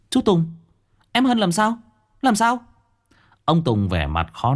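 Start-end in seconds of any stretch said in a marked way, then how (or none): 0.1-2.6 alarmed. Acted. Hồng Quyên asks her uncle, alarmed and high. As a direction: snap to attention in alarm. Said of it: "thì hốt hoảng".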